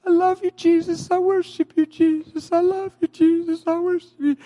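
high, devoted voice of a freed woman; imitating a worshipping woman.

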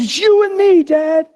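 put-on high, slow, pleading boy's voice; a distraught fourteen-year-old pleading with his dad, high; teenage son's voice. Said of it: "He says".

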